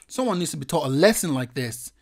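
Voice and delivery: ordinary delivery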